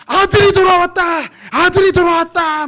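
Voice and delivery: high, loud neighborhood announcement; excited reenacted shout; shouting grandmother. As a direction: holler the news down the street. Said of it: "막 소리를 치기 시작하는 거예요".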